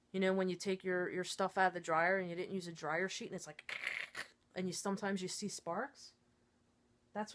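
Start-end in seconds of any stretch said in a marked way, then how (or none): none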